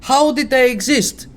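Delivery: high, loud incredulous ranting; mock ancient Greeks. Imagining ancient Greeks puzzling over centaurs, loud high mock rant. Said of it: "but Greeks went".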